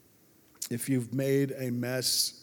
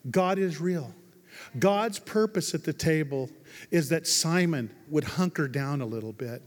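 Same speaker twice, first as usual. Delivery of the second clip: ordinary talk, nothing marked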